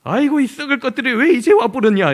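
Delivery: loud, high Jeolla grandma scolding fondly; 전라도 할머니의 거친 반가움. Retold or imitated. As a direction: holler it like a rough Jeolla grandmother. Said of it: "외할머니가 자기들한테 욕했다는".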